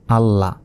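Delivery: আল্লাহ with the ল held double, ending on an open "la"; teacher's careful double-L in আল্লাহ; doubled lam. Shown as a demonstration. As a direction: sound ল twice in আল্লাহ, as "al-la". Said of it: "কারণ লাম অক্ষরের ওপর তাজদিদ".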